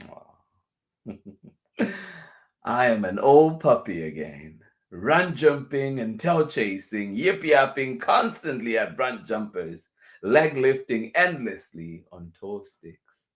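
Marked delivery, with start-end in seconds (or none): none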